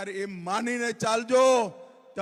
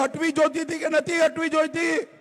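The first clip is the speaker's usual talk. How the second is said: louder; 5 semitones higher than usual. Shown as a demonstration. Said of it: "જોરથી બોલો".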